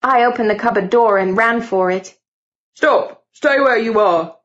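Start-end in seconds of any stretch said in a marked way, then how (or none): 2.7-4.4 shouted command. A narrator's reading. Servant catches intruder, shouts.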